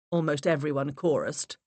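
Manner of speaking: plain reading voice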